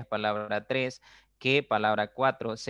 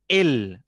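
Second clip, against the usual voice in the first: «El» tónico, fuerte y alargado; acento en «El»; 2 semitones higher, 6 dB louder than usual. Shown as a demonstration. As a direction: recalca «El» como palabra con tilde. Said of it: "lleva tilde". («El» con acento fuerte y marcado)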